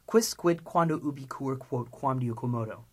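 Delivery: brisk drill with little gap between words; quick recitation. Shown as a demonstration. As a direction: run through the list quickly. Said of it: "Now, three times quickly".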